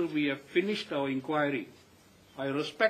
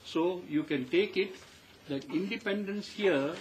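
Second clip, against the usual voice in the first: ordinary delivery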